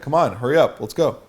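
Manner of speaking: urgent chivvying, pitched a bit up; commanding. Shown as a demonstration. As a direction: hustle her along, firm and loud. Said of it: "you guys are in a rush".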